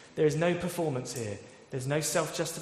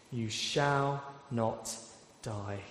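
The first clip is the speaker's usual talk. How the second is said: very slow, fading softer; solemn pardon; 3 semitones lower, 6 dB quieter, much slower than usual. Retold or imitated. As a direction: let each word land gently. Nathan's pardon, slowed.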